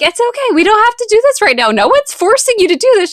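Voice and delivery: high, quick reassuring mother's voice; calm a frightened child quickly; high, hurried gentle reassurance; soothing mom. Retold or imitated.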